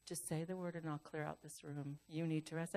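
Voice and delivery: low, hushed imitation of a nurse; discreet nurse aside. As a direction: drop right down, as if privately offering help. Nurse discreetly offers to clear room.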